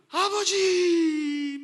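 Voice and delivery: a long, drawn-out, crying call to the Father; tearful cry. Shown as a demonstration. Pastor shows the congregation how Jesus cried out in prayer, with a long tearful wail. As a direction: wail the word like a weeping prayer. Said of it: "막 하면서 울면서".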